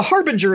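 Harbinger as the ordinary word, HAR-bin-jer; soft j in the middle of Harbinger; soft-g pronunciation. Shown as a demonstration. Joking about the omen word, Harbinger said HAR-bin-jer. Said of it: "that's the wrong name for a".

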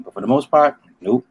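usual speaking voice

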